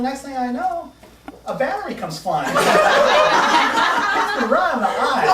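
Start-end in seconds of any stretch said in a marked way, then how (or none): 2.6-4.2 very high, loud burst of laughter; shrieking laugh. Real, not acted. Recalling the battery to the eye, high loud laughter. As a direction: crack up at the battery story.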